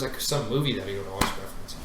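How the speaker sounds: normal talking voice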